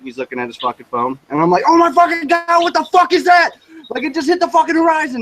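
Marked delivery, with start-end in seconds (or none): 1.7-5.2 high, loud shout of shock; retold screaming. Driver yelling at passenger about a UFO, retold screaming. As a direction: yell it in astonished alarm, re-enacted. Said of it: "he's screaming at me".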